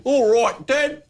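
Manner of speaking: high put-on voice of a son; imitated kid mocking his dad's accent; son's impersonation. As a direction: pipe it up high, mimicking Dad's talk. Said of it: "he does the impersonation".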